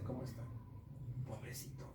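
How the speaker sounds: hushed imitation of someone feeling sorry; mock pity. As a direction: murmur it pityingly, imitating a devotee. Mimics someone pitying crucified Christ.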